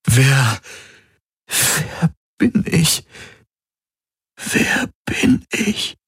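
very high, breathy, slow and halting; lost child. A narrator's reading. Man who has forgotten himself, like a lost child. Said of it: "Er klang wie ein verlorenes Kind".

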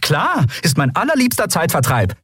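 high, heated sarcasm; sarcastic. A narrator's reading. Captain answering Keru's accusation, sarcastic. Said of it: "Dein Sarkasmus".

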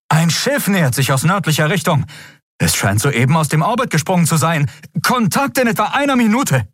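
urgent bridge officer, pushing through it; alarmed crew voice. A narrator's reading. Crew member reports incoming ship. (höhere, gehetzte Meldung eines Crewmitglieds)

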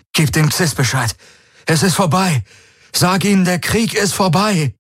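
high, urgent winded calling; breathless, rising urgency; out of breath. A narrator's reading. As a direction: call the news out breathlessly. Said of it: "rief Garland außer Atem".

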